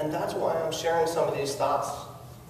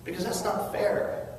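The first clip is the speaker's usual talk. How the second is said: ordinary delivery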